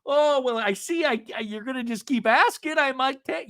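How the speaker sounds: high, drawn-out mock confession; Sandovalian dramatics. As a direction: declaim it like a martyr coming clean. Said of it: "I feel like it's very Sandovalian".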